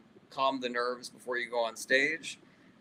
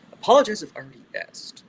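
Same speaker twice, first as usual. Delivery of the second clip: higher, louder Twilight Zone narrator voice; Rod Serling; 7 semitones higher, 8 dB louder than usual. Retold or imitated. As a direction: intone it like the host of The Twilight Zone. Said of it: "Rod Serling, can we hear a little?".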